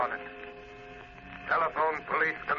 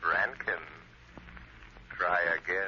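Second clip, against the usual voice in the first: lower, smug taunting voice; mocking phantom; 7 semitones lower, 4 dB quieter than usual. The Shadow taunting Rankin, low and smug. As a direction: taunt them from nowhere.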